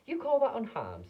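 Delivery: high, loud indignant snap; mother snapping at Wonka about her tiny son; angry snap. A narrator's reading. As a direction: throw it back in outrage. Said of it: "snapped Missus T V".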